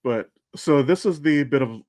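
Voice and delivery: ordinary talk, nothing marked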